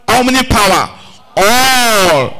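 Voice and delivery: "All" belted high, long and loud; emphatic ALL. Real, not acted. Preacher quotes scripture to the congregation, stressing "All" hard. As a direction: hammer the word "All" and stretch it out. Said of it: "Take note of that word again".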